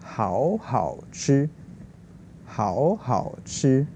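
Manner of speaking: 好好吃 repeated very slowly with each tone marked; slow clear tones. Shown as a demonstration. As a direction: echo 好好吃 slowly like a teacher drilling. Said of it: "So this means very".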